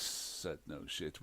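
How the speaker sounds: usual speaking voice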